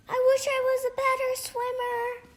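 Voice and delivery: high, quick little creature voice, pitched well up; a small voice wishing it could swim better; wishing little sea creature. Acted. Kelp wishes while swept away.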